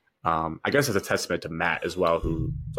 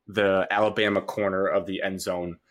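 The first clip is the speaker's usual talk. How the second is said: usual speaking voice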